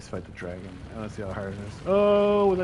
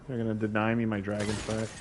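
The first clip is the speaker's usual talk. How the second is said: usual speaking voice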